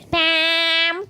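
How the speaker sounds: lifted, sing-song Bam; playful name call; playful high call. Real, not acted. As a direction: toss the name up like a joke. Playing with the name.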